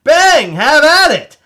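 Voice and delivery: sudden loud burst of hype; excited bang. Real, not acted. Host hyping pin buyers, loud and excited. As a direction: shout it like a sales pitch.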